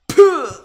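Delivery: sudden high, loud puff; dog noise. Pretend. Describing the ending, the dog lets out a high, loud puff. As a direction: blurt it out like a startled dog. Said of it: "and the dog kinda goes".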